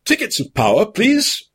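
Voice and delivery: lightly voiced demanding inspector; a hint of a stern ticket inspector; the inspector demands tickets. A narrator's reading. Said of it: "demanded the Isengard".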